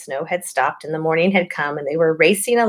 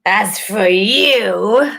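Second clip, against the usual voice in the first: higher, slow and cold; slow cold witch; slow menacing witch; 4 semitones higher, 4 dB louder, much slower than usual. A narrator's reading. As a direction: draw it out menacingly. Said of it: "said the witch".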